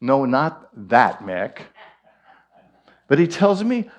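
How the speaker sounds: ordinary talk, nothing marked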